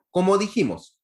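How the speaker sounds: usual speaking voice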